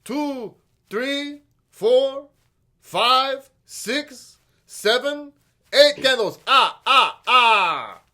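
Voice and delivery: counting candles with a dramatic flourish; Count von Count counting. Retold or imitated. Counting for the kids like the Sesame Count, ending in a big laugh. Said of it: "reminds me of Shalom Sesame".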